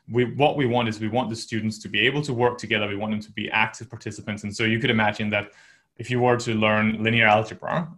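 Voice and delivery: ordinary talk, nothing marked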